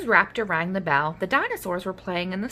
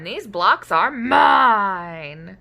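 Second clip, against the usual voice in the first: stubborn; 3 dB louder, much slower than usual. Acted. Defiant claim.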